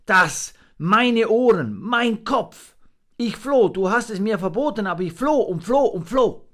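put-on high, loud, breathless young monkey; frightened little monkey. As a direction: blurt it out, still shaking. Monkey Fips tells his flight.

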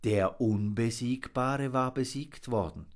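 ordinary delivery

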